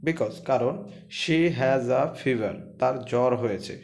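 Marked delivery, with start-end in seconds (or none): 1.2-2.5 words said slowly and clearly; slow classroom dictation; slow reading. Shown as a demonstration. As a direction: read it slowly so students follow. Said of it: "teacher reads sentence slowly".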